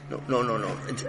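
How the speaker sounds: plain reading voice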